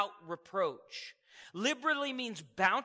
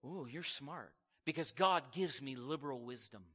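lower, much softer cooing; mock admiring; 7 semitones lower, 6 dB quieter than usual. Preacher imitates bystander praising, low soft coo. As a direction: coo like an impressed friend. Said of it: "You say".

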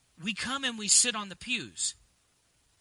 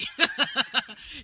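amused laugh at own joke; real laugh; 4 semitones higher than usual. Real, not acted. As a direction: break into a short hearty chuckle. Laughs after calling self nobody.

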